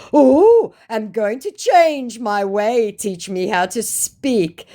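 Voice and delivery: drawn-out wheedling promise; creepy scammer. Retold or imitated. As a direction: scammer begging to be taught, slow and creepy. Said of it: "said my now getting rather creepy scammer".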